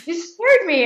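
high-pitched, acted-out panicky accusation; startled protest. Wife scolding husband who startled her, startled protest.

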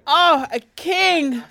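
dreamy excited awe; swooning gasp. Real, not acted. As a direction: gush over him.

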